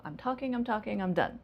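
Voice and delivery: talking rises, done falls; rise then fall. Shown as a demonstration. Teacher modeling rising then falling intonation. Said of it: "how we use rising and falling".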